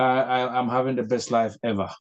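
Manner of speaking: normal talking voice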